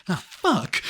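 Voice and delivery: high, loud dismayed curse; caught guy. Retold or imitated. The caught man cursing as the host appears. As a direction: blurt it out as the trap springs. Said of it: "the guy's like".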